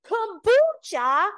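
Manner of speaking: very high, loud, stretched-out KOMBUCHA; mocking incredulity. Man ridiculing her favorite drink, hammed-up mocking imitation. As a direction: draw out Kombucha in exaggerated high disbelief. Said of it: "pretending he's laughing".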